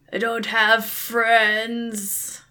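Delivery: slow, gloomy ogre reply. Acted. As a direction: drag out each word like a sad ogre.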